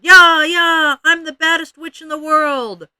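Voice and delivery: high, loud playground yell; screaming witch taunt. A narrator's reading. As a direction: holler it at full tilt, high and gleeful as a taunt. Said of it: "Ramona ran screaming into the playground".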